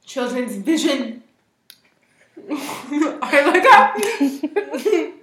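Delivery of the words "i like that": laughing it out; giggling with delight. Real, not acted. Amused by phrase.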